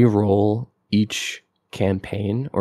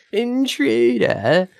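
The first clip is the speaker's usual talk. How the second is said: high, slow, drawn-out Cockney yell; Cockney shout; 14 semitones higher, much slower than usual. Retold or imitated. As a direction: stretch out "Intruder!" like a Cockney guard. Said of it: "Cockney accent".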